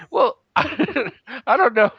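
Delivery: high-pitched words through real laughter; laughing. Real, not acted. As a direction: laugh helplessly while trying to answer. Teased about shame, laughs while answering.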